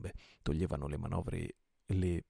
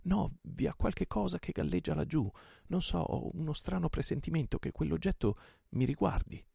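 higher, tense hero's voice; a captain gripped by a strange hunch; Sandokan's voice; 10 semitones higher than usual. A narrator's reading. Sandokan uneasy, strange premonition.

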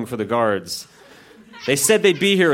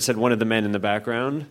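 usual speaking voice

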